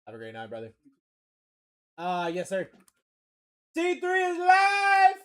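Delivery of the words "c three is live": drawn-out hyped shout, pitched way up; big slow announcer shout; hype shout. Real, not acted. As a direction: sell the hit like an announcer. Breaks hype, shouts card live.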